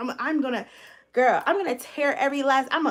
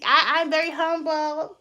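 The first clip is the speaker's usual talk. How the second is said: mock humble; 3 semitones higher, 3 dB louder, slower than usual. Shown as a demonstration. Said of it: "I had to get a little messy".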